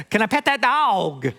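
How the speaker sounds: high-pitched imitated Southern little girl; Southern accent. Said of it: "grow up with this Southern accent".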